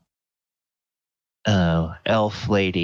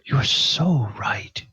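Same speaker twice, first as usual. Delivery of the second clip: higher, partly whispered plea about rising above; higher-pitched earnest confession with whispered start; earnest young woman; 7 semitones higher than usual. Acted. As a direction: voice a heartfelt young woman confiding her past. GM holds a pleading character voice.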